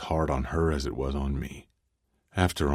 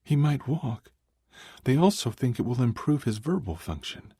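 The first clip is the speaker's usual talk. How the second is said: female voice; 9 semitones higher than usual. Acted. Tiffany voiced, upset.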